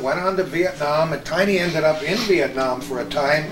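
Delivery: ordinary talk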